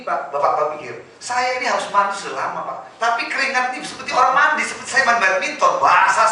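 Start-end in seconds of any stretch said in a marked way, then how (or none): none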